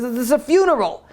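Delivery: high-pitched retold rebuke; indignant soldier. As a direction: scold him with the pitch held high. Soldier confronting the crying smuggler.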